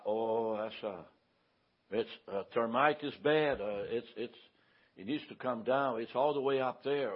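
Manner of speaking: soft, low, hesitant reply; gentle quoted tradesman. Retold or imitated. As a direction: quietly imitate a mild, hesitant workman. Quoting the mild tree man.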